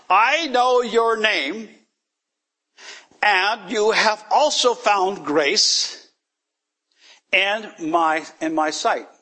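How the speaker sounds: high, slow, solemn reading of the verse; scripture reading. Acted. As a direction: read the scripture slowly and reverently, pitched high. Scripture read aloud.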